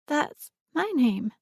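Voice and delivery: put-on high, slow little girl's voice; a small child holding back her excitement; guarded excitement. A narrator's reading. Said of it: "guarded excitement in her voice".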